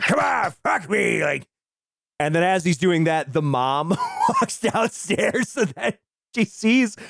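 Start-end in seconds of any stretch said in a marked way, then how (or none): 0.0-1.2 slow, high mock shout; taunting shout. A podcaster retelling the father goading his son, in a slow mock shout. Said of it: "He's like".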